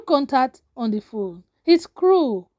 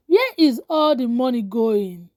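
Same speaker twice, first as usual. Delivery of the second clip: ordinary delivery, nothing marked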